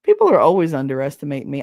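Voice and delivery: ordinary talk, nothing marked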